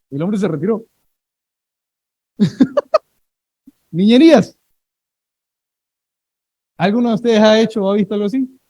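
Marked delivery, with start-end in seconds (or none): 3.9-4.6 a loud, emphatic Niñerías to close the story; loud punchline. Real, not acted. Preacher hammering the lesson to the congregation, loud and emphatic. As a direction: declare Niñerías loud to end the anecdote.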